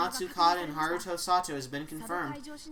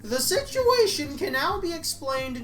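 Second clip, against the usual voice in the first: higher, louder and slow, almost shouting; high excited declaration; 8 semitones higher, 6 dB louder, slower than usual. Acted. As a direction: announce it high and loud, deliberately. Voices excited character.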